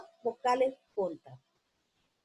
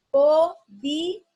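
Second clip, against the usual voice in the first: loud slow dictation; 3 semitones higher, 7 dB louder, much slower than usual. Shown as a demonstration. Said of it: "Ahora está la palabra podía".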